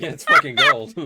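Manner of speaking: high, loud, amazed cry; awestruck. Acted. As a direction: gasp it out in wonder. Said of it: "all fear is just like".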